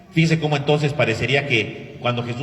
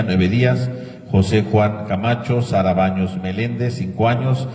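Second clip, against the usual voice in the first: louder, steady list of names; reading names; 2 semitones lower, 6 dB louder than usual. Acted. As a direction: read out the names one by one. Priest reads names of deceased.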